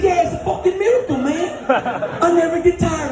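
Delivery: high-pitched, amazed insistence; running partner's elated claim. Retold or imitated. Running partner tells his broken friend a miracle, elated.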